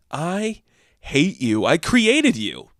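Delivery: very slow, drawn-out contempt; hammed-up slow seething; seething Zeus. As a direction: spit out each word slowly as an outraged father god. Zeus rages at Diana.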